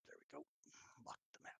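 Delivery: unvoiced murmur to himself; whispered aside. Real, not acted. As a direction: whisper it while fiddling with the map. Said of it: "mutters to himself".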